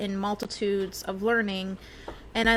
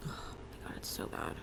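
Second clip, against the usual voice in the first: whispering; 16 dB quieter than usual. Real, not acted. Burping complaint, unvoiced.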